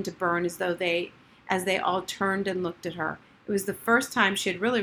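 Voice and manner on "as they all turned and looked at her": ordinary delivery, nothing marked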